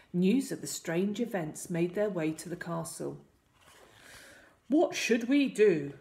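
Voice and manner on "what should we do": loud, slow, higher royal question; king seeking advice. A narrator's reading. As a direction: put it urgently to your advisors. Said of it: "the king asked his advisors".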